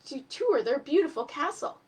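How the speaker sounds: put-on lively read-aloud voice for children; story narration. A narrator's reading. Teacher reading a storybook to children, lively. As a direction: tell the tale to a class, animated. Reads a picture book aloud.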